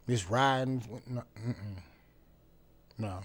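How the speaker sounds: usual speaking voice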